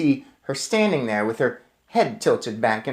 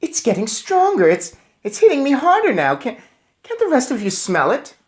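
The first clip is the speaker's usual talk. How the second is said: excited; 11 semitones higher, 3 dB louder than usual. Acted.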